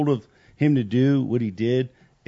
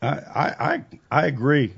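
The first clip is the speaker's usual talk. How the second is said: usual speaking voice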